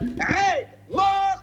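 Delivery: high dramatic shout. Retold or imitated. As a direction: cry out each word. Dramatic climax.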